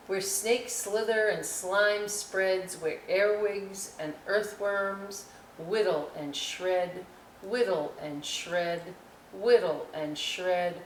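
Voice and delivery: slow, rhythmic chanted refrain; poem reading. Acted. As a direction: savour the rhythm of whittle and shred.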